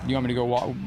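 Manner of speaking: ordinary delivery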